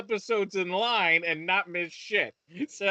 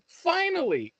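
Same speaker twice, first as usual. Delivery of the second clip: FINALLY leapt up high and punched louder; emphatic repeat; 10 semitones higher, 4 dB louder, slower than usual. Real, not acted. Ranting to his co-host about the CEO shooting, Finally flung out high and loud. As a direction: throw Finally out as an outraged, high exclamation.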